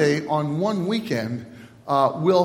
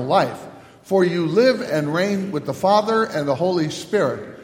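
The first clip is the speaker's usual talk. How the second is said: unison prayer; 4 dB louder than usual. Acted. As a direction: pray it aloud together, evenly paced. Let us pray this prayer together.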